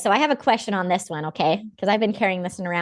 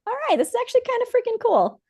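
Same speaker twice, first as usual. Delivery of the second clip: mock delight; faster than usual.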